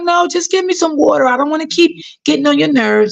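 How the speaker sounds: put-on a sweet old lady not wanting to be a bother; elderly lady's voice. Old patient asking her carer for water, high and gentle.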